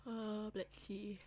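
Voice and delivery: slow, yawning through words. Real, not acted. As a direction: talk mid-yawn. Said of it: "I don't know why I keep yawning".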